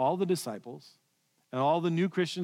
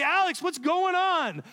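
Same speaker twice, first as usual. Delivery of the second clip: freaking out; 9 semitones higher than usual. Pretend. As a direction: shriek at Alex in mock alarm as the boat floods. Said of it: "I'm gonna freak out".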